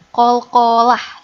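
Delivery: Kolkolah slow and loud; emphatic term. Shown as a demonstration. Teacher stresses the rule name. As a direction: announce Kolkolah slowly and firmly. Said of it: "perlu diperhatikan".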